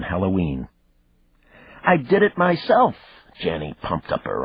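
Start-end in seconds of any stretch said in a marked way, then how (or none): 1.8-3.0 put-on very high, louder child voice; a small girl bursting with pride; proud little girl. A narrator's reading. Daughter showing her father her room, proud child voice.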